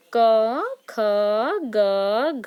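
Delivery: teacher leading a repeat-after-me drill; model pronunciation. Shown as a demonstration. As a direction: spell out क ख ग घ for children to repeat. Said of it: "मेरे साथ साथ आप उच्चारण कीजिएगा".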